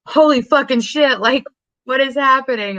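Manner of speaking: hammed-up disbelief; mock shock. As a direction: act out a stunned discovery. Retold husband's shock at the tape.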